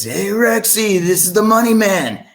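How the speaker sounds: high-pitched imitation of a caller's voice. Singer phones the sound man out of the blue, high imitated voice. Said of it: "I hear this voice, and it's".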